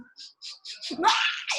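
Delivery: mock bawling burst, mostly voiceless; imitated child's wail. Mother shows how her scolded daughter began bawling, imitated wail. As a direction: imitate a kid about to burst out crying. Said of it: "ela fez aquele choro seguinte".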